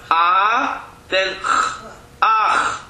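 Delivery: ah first, then a throaty ch, making ach; vowel before ch. Shown as a demonstration. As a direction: open on ah, then scrape the ch. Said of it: "you first make the sound of the vowel".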